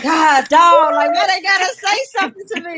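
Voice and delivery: annoyed grumble. Retold or imitated. Imitating her old self grumbling at a neighbor's greeting, exasperated. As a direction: groan it like being greeted is a burden. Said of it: "I'd be like".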